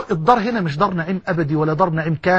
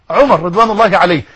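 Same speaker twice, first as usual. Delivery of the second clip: ordinary talk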